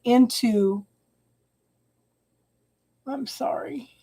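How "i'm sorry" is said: real whisper, barely voiced; whispered apology. Real, not acted. Reader apologizing to client mid-session, in a whisper. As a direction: breathe the apology out under your breath.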